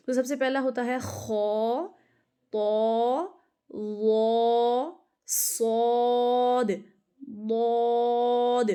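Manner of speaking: خا، طا، وا، صاد، ضاد، غین، قاف each drawn out slowly with a full, heavy mouth; heavy letters demo. Shown as a demonstration. Teacher modelling the seven heavy letters for students, slow and full. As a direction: stretch every letter name long and thick, as a model to copy. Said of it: "ان کو آپ نے ایسے ہی نکال کے پڑھنا ہے".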